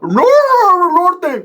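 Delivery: very high, drawn out barking chant; dogs barking norte. Retold or imitated. Storyteller has the pit bulls bark norte, high. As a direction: bark the word like a dog.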